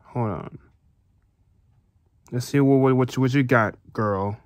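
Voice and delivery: plain reading voice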